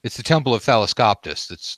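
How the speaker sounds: ordinary talk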